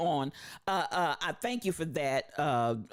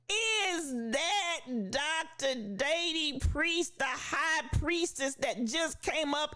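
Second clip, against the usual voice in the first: delighted surprise; 8 semitones higher, slower than usual. Real, not acted. Spots a friend joining the stream.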